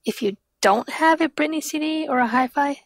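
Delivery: ordinary delivery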